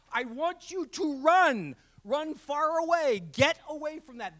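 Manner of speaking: loud, high, drawn-out plea to flee; urgent mock warning. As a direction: warn them off with hammed-up alarm.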